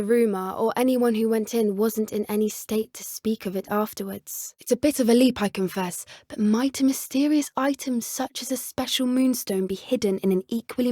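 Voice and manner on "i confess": ordinary talk, nothing marked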